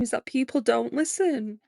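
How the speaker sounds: ordinary talk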